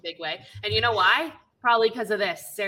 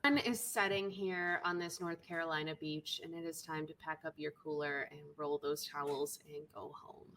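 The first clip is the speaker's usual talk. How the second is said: announcer sign-off; 2 semitones lower, 9 dB quieter than usual. Acted. Podcast outro scene.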